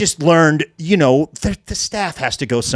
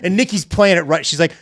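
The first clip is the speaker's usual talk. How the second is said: ordinary talk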